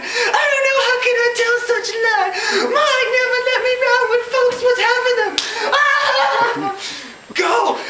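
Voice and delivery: very high, loud, sobbing shrieks; a panicked maid falling apart; hysterical wailing. Acted. Prissy wails to Scarlett she knows nothing about birthing, shrieking and sobbing.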